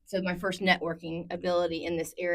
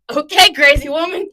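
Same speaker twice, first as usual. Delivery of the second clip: high, loud laughing tease; mock the crazy woman fondly; teasing son; 10 semitones higher, 16 dB louder than usual. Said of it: "he's like".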